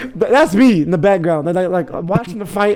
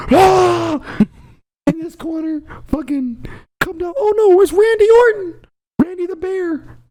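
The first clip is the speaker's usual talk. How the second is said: high, hyped ring announcer calling the entrance; wrestling announcer; 12 semitones higher, slower than usual. Pretend. Announcing the bear's entrance like a WWE match, high and hyped up. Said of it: "like a W W E coming in".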